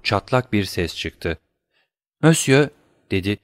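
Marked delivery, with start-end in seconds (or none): 2.2-2.7 frightened. Acted. Terrified wife answers accuser.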